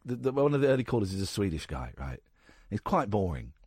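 ordinary talk, nothing marked

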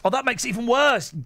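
ordinary delivery

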